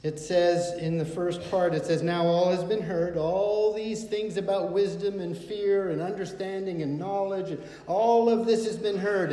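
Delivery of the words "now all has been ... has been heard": lifted, louder preaching of the verse; preacher quoting Ecclesiastes with rising force. Acted. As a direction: proclaim it to the hall.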